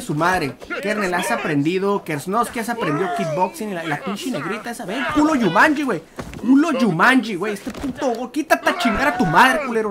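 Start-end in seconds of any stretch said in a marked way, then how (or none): 4.9-9.9 heated taunting. Real, not acted. Taunts opponent during in-game fight.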